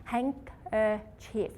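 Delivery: handkerchief said very slowly, each syllable apart; slow syllable demo. Shown as a demonstration. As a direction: stretch handkerchief out beat by beat. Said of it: "let's say handkerchief".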